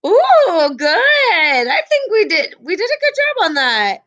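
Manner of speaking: high, laughing praise; delighted. Real, not acted. Teacher praising class after quiz, delighted. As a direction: cheer the class on, beaming.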